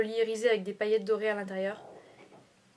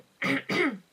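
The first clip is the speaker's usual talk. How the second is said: throat clearing, frog in throat; 4 semitones higher, 5 dB louder than usual. Real, not acted. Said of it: "Je vais y arriver".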